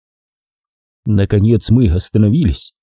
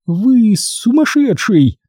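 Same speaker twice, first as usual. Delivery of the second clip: high, slow moaned cry; moaning; 12 semitones higher, much slower than usual. Acted. Corola moans at the narrator in despair. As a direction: moan it out in despair. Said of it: "простонала она".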